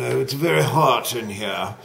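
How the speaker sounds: put-on a light British accent on hot; hint of a British voice, open o in hot. Teacher imitates how British people say hot, lightly. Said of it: "the British people say".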